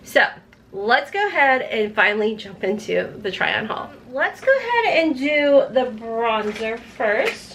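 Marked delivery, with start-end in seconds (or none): none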